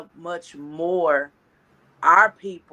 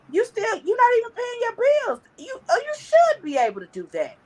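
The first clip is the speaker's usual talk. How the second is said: much higher, faster, a put-on scolding voice; mock the showoff in a squeaky scold; faster than usual.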